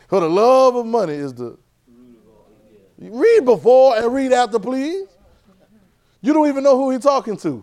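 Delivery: loud, high, emphatic preaching; fiery sermon delivery; preaching at full force. Acted. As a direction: drive the point home to the congregation. Preacher raises voice on scripture.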